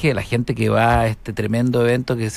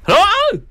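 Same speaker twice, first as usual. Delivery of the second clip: short high loud line-call shout; grito de juez de línea; 5 dB louder than usual. Shown as a demonstration. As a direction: yell out like a line judge. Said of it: "yo gritaría así". (grito agudo de juez de línea)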